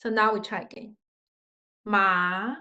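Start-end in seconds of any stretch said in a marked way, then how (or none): 1.9-2.6 "Ma" low at first, then rising; second tone. Shown as a demonstration. Teacher demonstrating second tone, "Ma" rising slowly. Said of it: "Pronounce about two second tone".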